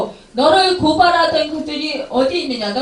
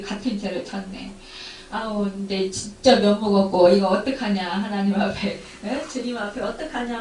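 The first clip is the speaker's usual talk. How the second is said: much softer, sinking groan of dismay; dismayed self-talk; 7 semitones lower, 6 dB quieter than usual. Retold or imitated. Preacher voicing a believer ashamed after sinning again, hushed. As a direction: mutter your despair under your breath. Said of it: "막 부들부들 떨잖아요".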